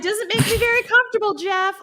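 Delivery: high, fast fake-innocent contestant; high mock-sweet contestant answer. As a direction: play coy and nervous to the host. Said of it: "you'd be doing that like".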